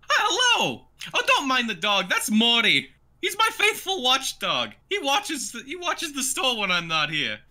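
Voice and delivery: very high-pitched cartoon shopkeeper; Nico the cat merchant. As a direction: greet customers in a piping cat-merchant voice. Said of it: "You go".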